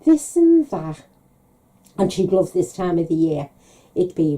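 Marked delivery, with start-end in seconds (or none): 0.0-1.0 higher, louder mock put-on dodge; coy evasive. Mum brushes off her daughter's question again. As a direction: sing it out as a cheeky brush-off.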